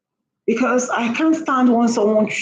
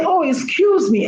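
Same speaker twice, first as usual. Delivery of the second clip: polite excuse; 6 semitones higher than usual. Retold or imitated. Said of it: "Instead of you to say".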